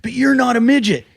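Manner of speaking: high-pitched mock protest; protesting comeback. Imitating Nick defending himself to the comic, high protest.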